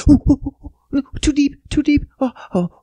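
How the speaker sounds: high-pitched put-on yelps; hammed-up high moaning; mock sexual squeal. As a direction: squeal it in fake alarmed arousal. Mock sex scene, playing the receiver.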